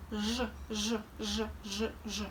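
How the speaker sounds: zh with lips rounded as for a kiss. Shown as a demonstration. Teacher shows rounded lips to students, soft rounded zh. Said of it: "our lips are more rounded".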